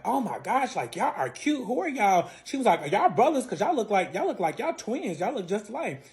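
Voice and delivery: high, bubbly girly voice mimicking strangers; gushing girl. Strangers on the cruise gushing at the couple, high girly mimic. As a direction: squeal it like an excited stranger.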